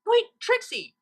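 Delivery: high, loud call after her; exclaiming. A narrator's reading. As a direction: call her back before she leaves. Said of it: "exclaimed Twilight".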